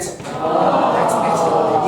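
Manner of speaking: very slow, sung-out line; slow sing-song. Acted. Nanny Night Nurse reacts to the sad music, slow sing-song. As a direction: stretch it out like a lament.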